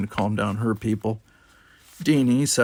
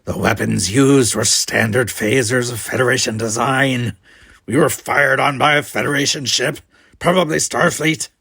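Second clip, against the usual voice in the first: loud accusation; 2 semitones higher, 8 dB louder than usual. Acted. Klingon accusing the cadets.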